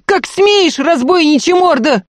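very high, loud indignant shriek; outraged cry. A narrator's reading. Maid berating the robber who struck her lady, shrieking. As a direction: scream the rebuke at full pitch. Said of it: "вскричала Дунька".

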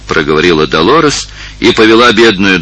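ordinary delivery

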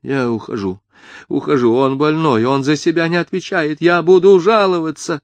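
rising, high agitated muttering; flustered babbling. A narrator's reading. Mother backing to the exit, flustered and agitated. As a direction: build from a murmur to a high complaint. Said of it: "бормотала она".

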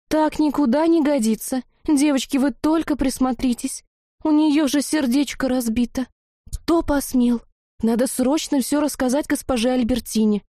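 put-on high, girlish indignant fussing; outraged concern. A narrator's reading. Witch girl outraged at newcomer's broken heart, fussing. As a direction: fuss indignantly on her behalf.

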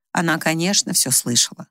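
plain reading voice

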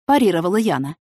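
usual speaking voice

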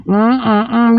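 normal talking voice

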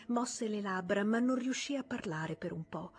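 ordinary delivery, nothing marked

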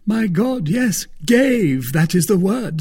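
high, loud insistence; emphatic Porthos. A narrator's reading.

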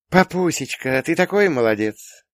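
put-on high, gushing girlish praise; playful daughter. A narrator's reading. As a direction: tease dad in a high girl's voice. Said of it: "Юля шутливо помутузила меня". (шутливо, высоким голосом дочки)

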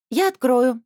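high, loud eager shout; eager call. Acted. Girl springs up at the doorbell, eagerly calling out. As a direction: jump up and call out, excited. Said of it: "подпрыгнула Анжелика и метнулась".

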